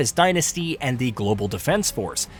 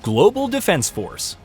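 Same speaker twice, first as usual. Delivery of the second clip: top ten countdown; 5 semitones higher, slower than usual. Acted. List item heading.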